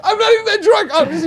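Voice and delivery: giggling, high voice; tipsy denial through laughter. Real, not acted. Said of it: "You are the drunk uncle so much right now".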